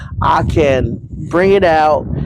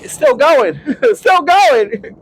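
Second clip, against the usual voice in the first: laughing disbelief; 11 semitones higher, slower than usual. Real, not acted. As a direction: laugh at the noisy jet. Jet noise overhead.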